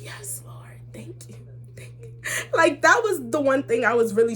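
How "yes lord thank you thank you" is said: barely audible relieved whisper; whispered thanks. Retold or imitated. Daughter thanking the Lord that dad won't know, whispering. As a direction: whisper it like a secret prayer. Acting secret relieved prayer.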